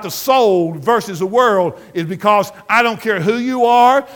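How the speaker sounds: full-force preaching. Acted. Preacher retelling his rebuke to the congregation, loud and relentless. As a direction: hammer every word at the woman.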